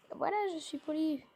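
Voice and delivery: ordinary delivery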